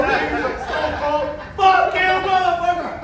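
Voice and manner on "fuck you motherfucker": higher, louder jeering yell; mock taunt shout. Comic voicing the alpha monkey taunting Mitch, yelling. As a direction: bellow it like a gloating brawler.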